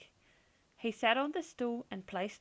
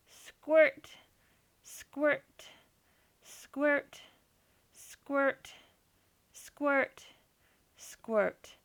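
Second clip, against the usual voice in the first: squirt sound effect; 4 semitones higher, 3 dB louder, much slower than usual. A narrator's reading. Milk squirts into the bucket.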